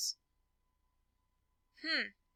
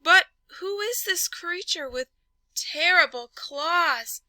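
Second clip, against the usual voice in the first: higher, louder alarmed storytelling; 7 semitones higher, 8 dB louder than usual. Acted. Narrator gasping at the monster's claws and teeth. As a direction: build the fright.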